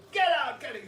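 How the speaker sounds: loud, high evil monk song; dramatic screaming. Retold or imitated. Auditioning monk screams an evil song at the panel, dramatic and loud. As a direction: belt it out like a villain at an audition. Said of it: "really dramatic and screaming".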